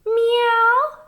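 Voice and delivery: high, loud meow; cat meow. A narrator's reading. Cat on the tractor, a high loud meow. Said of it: "said Cat".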